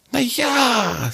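high, slow snake voice, mocking; teasing snake. A narrator's reading. Snake answers.

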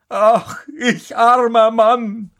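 high, drawn-out wail; lamenting cry. A narrator's reading. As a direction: stretch out the carter's self-pitying call. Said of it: "rief er". (hoher, gedehnter Klageruf)

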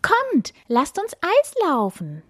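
high, eager little deer; excited call. A narrator's reading. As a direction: call the friends along brightly. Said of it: "rief das kleine Reh". (begeistert gerufen)

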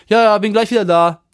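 loud, high mock brush-off; imitating the driver. Driver brushing off the narrator, loud and high. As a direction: toss it off like the careless driver. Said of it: "er meinte so".

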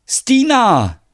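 high, loud call across the yard; loud, high-pitched shout of a name; joyful call. Acted. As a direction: call out to your returning sweetheart. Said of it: "Finn ruft".

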